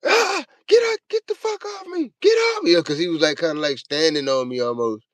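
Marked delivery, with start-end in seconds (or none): 0.0-2.7 put-on high frantic yells of a trapped kid; panicked screaming. Trapped under his cousin in a dumpster box, panicked screaming. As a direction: scream to get him off you, in a panic. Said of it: "I fucking started panicking".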